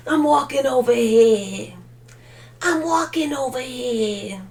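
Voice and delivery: mock gangster drawl, slightly nasal on the vowels; a light put-on mafia tough guy; mob voice. Vlogger hamming up a mob boss for chat, lightly done. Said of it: "My mob voice".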